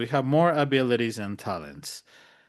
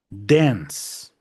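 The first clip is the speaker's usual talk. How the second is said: usual speaking voice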